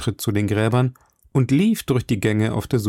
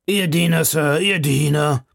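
bowing grub-man; 6 semitones higher than usual. Acted. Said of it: "tiefe Verbeugung".

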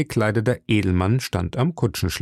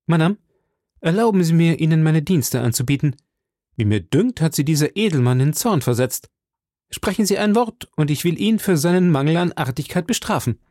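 higher, eager knightly courtesy; gallant young cavalier; 6 semitones higher than usual. Acted. D'Artagnan bows to Milady offering service, higher gallant voice. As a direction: offer your sword like a young hero.

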